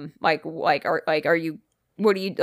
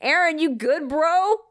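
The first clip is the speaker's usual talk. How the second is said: higher, calling out to a buddy; worried friend; 9 semitones higher than usual. Retold or imitated. Friend calling to Aaron who ran upstairs, higher worried call.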